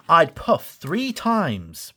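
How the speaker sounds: slow, light little-engine voice; slow peep. Acted. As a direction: say it slowly, like a small brave promise. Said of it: "Thomas peeped".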